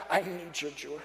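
plea dropped soft and low; pleading prayer. Acted. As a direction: let the prayer sink to a hushed plea. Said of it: "I just begin to pray".

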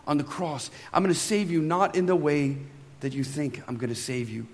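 God answering. Acted. God answers the pleading believer, low and calm. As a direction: speak as God, gently settling it. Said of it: "And God's like".

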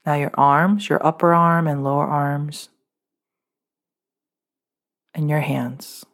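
usual speaking voice

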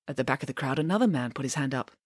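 ordinary delivery

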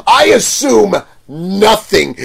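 loud, slow, high declaration; emphatic denial. Retold or imitated. Vendor rebutting the friend, emphatic and drawn out. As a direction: hammer it out slowly and loudly.

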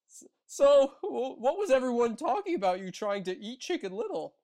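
high, loud stammering goose voice. Acted. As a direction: stutter it out like a nervous goose. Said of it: "she asked Foxy".

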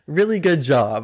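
sarcastically sweet approval; passive aggressive. Retold or imitated. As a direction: compliment them so it stings. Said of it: "a little passive aggressive".

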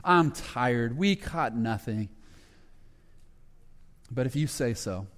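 mildly exasperated, worn-out grumble; weary frustration. Retold or imitated. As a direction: sigh it out like a man who caught nothing. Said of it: "he's just frustrated".

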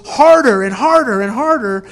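loud, driving repetition; cranking it up. Shown as a demonstration. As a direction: hit each repeat hard, like piling on pressure. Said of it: "He cranks it up to eleven".

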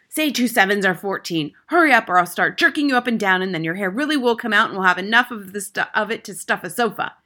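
loud, rushed threats; impatient, hectoring headmistress; Trunchbull bellow. A narrator's reading. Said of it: "bellowed the Trunchbull".

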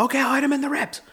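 very high, mock-determined reply; hammed-up squeaky eagerness; eager boxer. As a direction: play a fighter obeying his coach, pitch way up. Dreaming boxer answers his coach eagerly.

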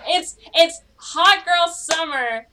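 slow, high, loud hype; hyped announcement. Real, not acted. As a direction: announce it like a slogan. Hyped announcement of hot girl summer.

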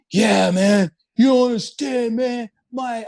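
hammed-up worked-up guy going off; imitating an angry rant. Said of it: "the guy was just going off".

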